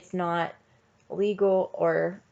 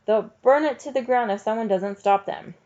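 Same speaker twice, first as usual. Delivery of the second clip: ordinary delivery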